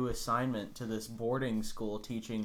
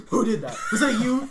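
higher, louder mock yell through laughter; mock teacher yell; 13 semitones higher, 10 dB louder than usual. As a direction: laugh while shouting like the strict teacher. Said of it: "and she goes".

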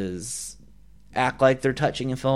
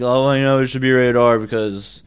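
lightly mocking gore-hungry viewer; 3 dB louder than usual. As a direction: mimic a know-it-all fan, louder then dropping. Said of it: "people who have not read the book probably think".

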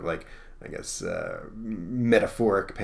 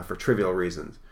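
usual speaking voice

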